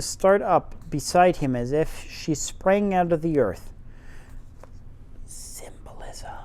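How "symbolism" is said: whispered aside. Real, not acted. Whispered aside while reading.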